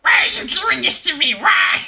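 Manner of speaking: loud, high crying wail; high gremlin wail. Retold or imitated. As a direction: sob it like a hurt creature. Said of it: "Dubas Goobas".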